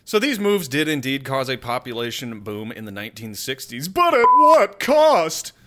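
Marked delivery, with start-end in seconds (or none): 3.9-5.5 slow, high, loud ominous question; dramatic. Acted. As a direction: ham up the doom.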